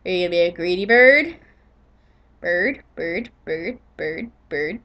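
coaxing the bird. Real, not acted. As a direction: gently lure the bird. Coaxing parrot softly.

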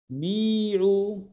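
بيعوا said slowly, long ee and oo; drawn-out model form. Shown as a demonstration. Teacher models the plural command, drawn-out بيعوا. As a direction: stretch both vowels of بيعوا.

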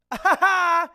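loud, high mocking cackle; mocking laughter. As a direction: laugh in their faces like schoolkids. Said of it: "They all made fun of me".

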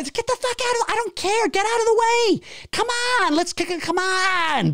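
high, exasperated driver yelling; angry driver. Retold or imitated. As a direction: yell at the pedestrian to move.

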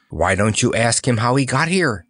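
ordinary delivery, nothing marked

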